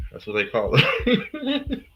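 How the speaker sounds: high-pitched laugh through the words, slowed; cracking up at the nickname. Real, not acted. Vlogger laughing to viewers about the nickname, laughing.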